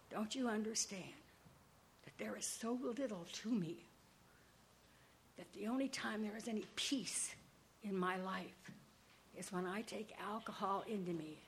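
telling her father why she drinks, softly and sincerely; quiet confession. Retold or imitated. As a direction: let it fall to almost a whisper.

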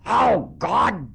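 mock screaming father. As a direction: bellow like an exasperated father. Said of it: "he just screaming his head off".

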